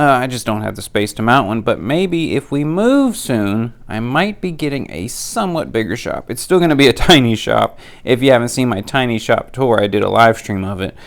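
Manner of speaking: ordinary talk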